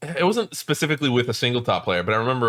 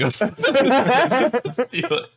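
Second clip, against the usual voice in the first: high, slow, breaking into laughter; helpless amusement, pitched way up; laughing; 11 semitones higher, much slower than usual. Real, not acted. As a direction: crack up while telling it. Laughing while retelling a prank.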